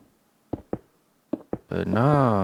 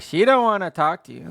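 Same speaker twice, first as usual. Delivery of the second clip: high snippy friend voice; 11 semitones higher than usual. Retold or imitated. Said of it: "and be like".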